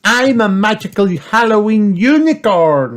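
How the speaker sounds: put-on high, loud proud girl voice; proud Peppa. A narrator's reading. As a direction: announce it proudly. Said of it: "said Peppa".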